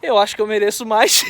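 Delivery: laughing. Retold or imitated. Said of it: "Eu só não falei rindo, mas eu falei sério".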